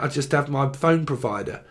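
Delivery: ordinary talk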